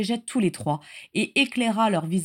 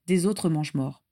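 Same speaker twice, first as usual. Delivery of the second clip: usual speaking voice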